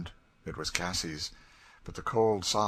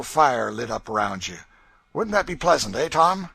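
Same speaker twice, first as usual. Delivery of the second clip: loud, higher, mocking menace; gloating threat; 5 semitones higher, 10 dB louder than usual. A narrator's reading. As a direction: relish the threat of burning him. Legree threatening torture.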